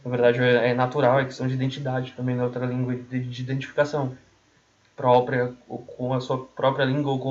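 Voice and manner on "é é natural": usual speaking voice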